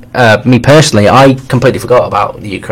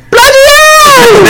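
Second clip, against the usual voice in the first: very high, drawn-out squeal of surprise; mock shocked Jack, slow and squealed; Jack impression; 3 dB louder, much slower than usual. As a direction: stretch it out in a shrieking mock Jack voice. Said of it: "It's an amazing Jack impression".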